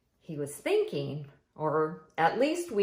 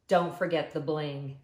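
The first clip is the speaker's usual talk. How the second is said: ordinary delivery, nothing marked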